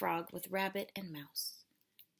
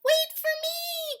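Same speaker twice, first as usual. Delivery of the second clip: high, slow little mouse call; mouse crying out; 7 dB louder than usual. Acted. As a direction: call after them as a tiny mouse. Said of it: "cried Mouse".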